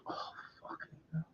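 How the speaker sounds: under-the-breath whispered swearing; muttered curse. Real, not acted. Cursing the itch aside to himself, whispered. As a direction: swear to yourself, nearly voiceless. Irritated by itching mid-sentence.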